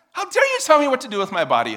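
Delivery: high, loud mock fury; scary outraged shout. Someone furiously confronting the nervous speaker, scary outrage. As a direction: explode in pretend indignation. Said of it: "that's just scary".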